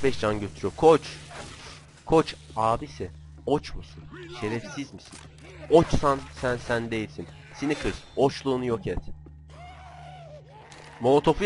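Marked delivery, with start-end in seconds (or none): none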